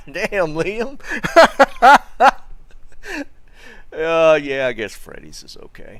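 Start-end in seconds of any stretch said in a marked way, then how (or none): 1.0-3.9 loud high burst of laughter, much louder; real laugh. Real, not acted. Streamer cracking up at Liam's chat message. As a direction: roar with laughter at the joke.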